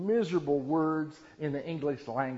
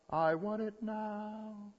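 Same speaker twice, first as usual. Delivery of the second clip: high, slow, drawn-out spoiled demand; Baruka; 7 semitones higher, 4 dB quieter, much slower than usual. Acted. Spoiled child in Willy Wonka demands it, whining. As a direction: stretch each word in a high whine. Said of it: "Like Baruka, Willy Wonka".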